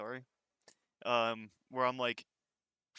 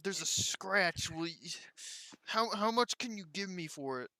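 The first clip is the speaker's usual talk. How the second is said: higher-pitched mock bargain hunter; haggling customer; 6 semitones higher than usual. Store worker mimicking customers angling for a discount, high and haggling.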